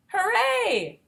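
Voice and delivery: high, loud burst of joy; cheerful hooray. Acted. Teacher cheering for the freed bus in the story, excited. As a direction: cheer like the bus just broke free.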